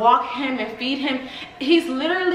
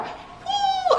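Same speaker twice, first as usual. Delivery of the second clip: high strained whoop. Real, not acted. Lifting the dog.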